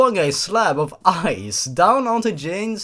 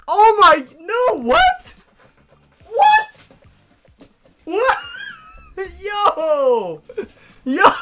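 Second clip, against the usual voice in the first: high, loud stunned yelps; can't believe what you're reading; shocked disbelief; 7 dB louder, much slower than usual. Real, not acted. Reader sees own name in story.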